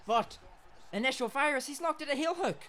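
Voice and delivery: high, loud play-by-play yell; excited shout. Real, not acted. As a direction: call the hit with a burst of excitement. Excited fight commentary.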